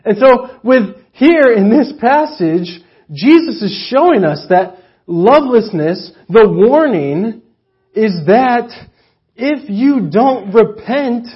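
loud, high preaching with slow weighty stresses; full-force sermon delivery. Real, not acted. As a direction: drive the point home to the congregation.

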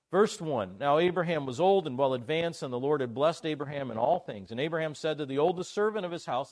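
loud, declamatory Bible reading; scripture reading. Real, not acted. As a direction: read the verse out to the congregation.

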